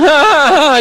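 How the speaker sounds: sudden high squeal as she faints; a silly high yelp, hammed up; mock squeal. Fainting woman lets out a mock high squeal.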